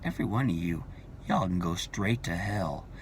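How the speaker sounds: cold dismissal. Pretend. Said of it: "I'm gonna look at you and say".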